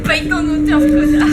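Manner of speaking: mock shout. Joking demand to pay the author.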